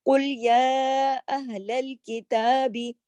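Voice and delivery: slow chanted recitation; measured recited verse; Quran recitation. Shown as a demonstration. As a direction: recite slowly like tajweed.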